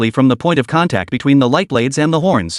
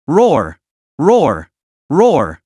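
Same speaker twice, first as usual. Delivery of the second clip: three bellowing beast roars in a row; fierce roars; much slower than usual. A narrator's reading. The Minotaur roars from the projection screen, fierce roars. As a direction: roar again and again like an enraged bull. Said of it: "a series of fierce roars".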